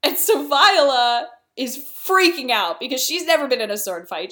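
ordinary delivery

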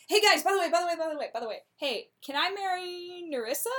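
high, loud, rushed interruption; a giddy eager blurt. Retold or imitated. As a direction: butt in excitedly, repeating yourself.

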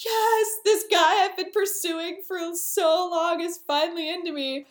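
high-pitched, contained squeal of delight; mock giddy triumph. Olivia finally getting the guy, high mock-giddy delight. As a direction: gush in a high, lovestruck voice. Olivia rejoices at winning her love.

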